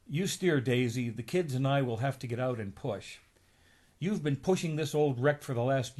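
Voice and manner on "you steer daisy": plain reading voice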